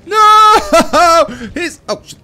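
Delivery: high, loud screaming with laughter breaking in; laughing panic. Real, not acted. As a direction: shriek in half-amused alarm. Creature attacks the player.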